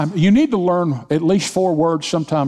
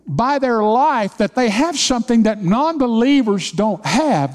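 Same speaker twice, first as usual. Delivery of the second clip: higher, building preacher's fervor; preaching at full force; 7 semitones higher than usual. Acted. Pastor urging his church to trust God, fervent preaching. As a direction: drive it home to the congregation. Rising sermon intensity.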